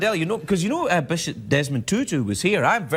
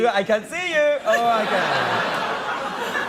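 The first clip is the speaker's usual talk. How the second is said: puppet voice; 7 semitones higher, much slower than usual. Pretend. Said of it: "he's like".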